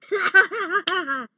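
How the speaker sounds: high, loud gleeful laugh; triumphant laugh. Acted. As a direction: laugh in giddy victory. Victory laugh after cheering.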